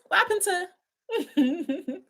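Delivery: drawn-out line that breaks into laughter; laughing. Real, not acted. Streamer amused at chat ignoring the vote, slow and laughing. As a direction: try to ask it but collapse into giggles.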